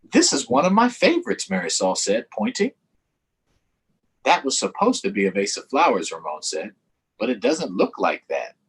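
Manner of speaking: put-on lively read-aloud for young kids; story read to children. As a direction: read the picture book with animated voices. Reading picture book aloud to children.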